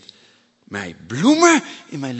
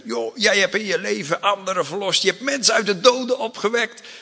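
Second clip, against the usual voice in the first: higher, quick mocking taunt; needling, raised-pitch jeer; 7 semitones higher, faster than usual. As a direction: taunt him from the next cross. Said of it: "honen hem".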